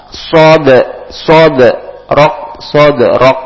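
"Sod" with the d bounced lightly, a small echo on "rok"; bounced qalqalah. Shown as a demonstration. Teacher drilling minor qalqalah for the students. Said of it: "qalqalah sughra, dipantulkan".

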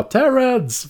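high, drawn-up mock call of a name. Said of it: "när någon då karaktär ropar".

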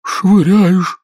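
high, loud call; animated storybook creature; excited turtle. A narrator's reading. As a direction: fling the word out. Mock Turtle building excitement.

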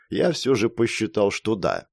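usual speaking voice

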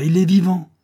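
high, shouted insistence; exclaimed high and loud; loud cry. A narrator's reading. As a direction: cry out the repeated claim. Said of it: "cria Bouche en cœur".

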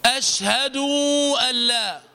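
أشهد with the final u stretched into oo; over-lengthened damma. Shown as a demonstration. Teacher demonstrates a grammatical slip, stretched u in أشهد. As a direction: drag the vowel on the dal, ashhaduu allaa. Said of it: "هكذا".

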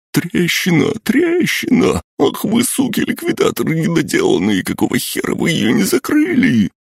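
very high, terrified screaming; panicked shriek. A narrator's reading. Panicked guard shrieking at crack.

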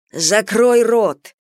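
barked. A narrator's reading. Tied-up prisoner to his mocking companion, barking. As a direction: bark it out, dragging each word. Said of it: "рявкнул Чахан".

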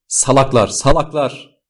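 high, falling scolding cry; exasperated shout. A narrator's reading. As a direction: shout it at a crowd of fools. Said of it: "diye bağırıyordu".

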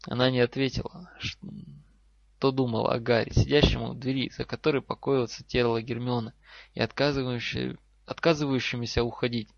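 ordinary delivery

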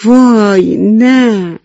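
high, loud cry of alarm, vowels drawn out; dismayed cry. A narrator's reading. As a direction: cry out in dismay as a little mouse. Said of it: "موش موشی فریاد زد".

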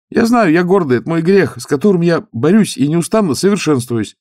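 high, loud zealous youth; eager young monk. Acted. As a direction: lift the voice, earnest and keen. Youth confesses pride.

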